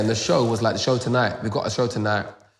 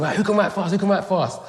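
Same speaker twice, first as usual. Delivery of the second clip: urgent ask; 10 semitones higher than usual. Retold or imitated. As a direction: put the room on the spot. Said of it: "he's thinking".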